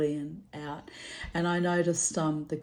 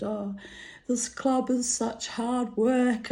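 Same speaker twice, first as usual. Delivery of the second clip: weary complaint; 6 semitones higher, slower than usual. Retold or imitated.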